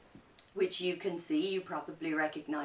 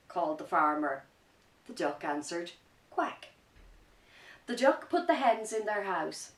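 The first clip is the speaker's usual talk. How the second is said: lively picture-book narration, a bit louder; reading to children; 7 dB louder than usual. A narrator's reading. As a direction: tell the story with energy for kids.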